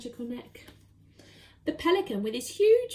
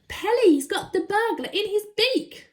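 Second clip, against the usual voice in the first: high, loud triumph; excited cheer; 9 semitones higher, 6 dB louder than usual. Acted. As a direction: announce it gleefully. Monkey cheers.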